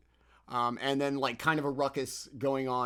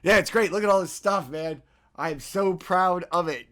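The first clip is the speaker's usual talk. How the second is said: louder, higher, beaming; manic pride; 4 semitones higher, 7 dB louder than usual. Acted. Sleepless baker showing off his pastries, loud and giddy. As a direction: show off the pile like a proud wired baker.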